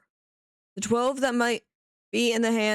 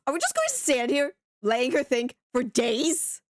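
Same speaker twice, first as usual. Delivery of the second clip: ordinary delivery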